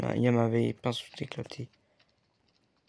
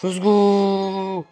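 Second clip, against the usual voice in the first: excited; 6 semitones higher, 6 dB louder than usual. Real, not acted. Joie après réussite.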